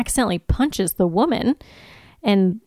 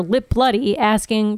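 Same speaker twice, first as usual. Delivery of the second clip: normal talking voice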